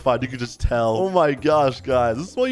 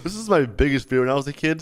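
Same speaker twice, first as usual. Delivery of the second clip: ordinary talk, nothing marked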